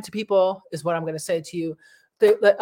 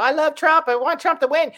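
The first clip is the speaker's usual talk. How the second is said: gushing fan voice, pitched higher and louder; mock Trump fan; 8 semitones higher, 5 dB louder than usual. Vlogger mocking Trump fans, gushing high and loud. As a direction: play the fan who can't get enough of Trump. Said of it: "Y'all talking about".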